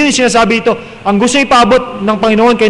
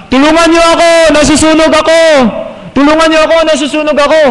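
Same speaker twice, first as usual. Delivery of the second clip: mock cry for help; 5 semitones higher than usual. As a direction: play a man crying he is burning. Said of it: "sisigaw siya".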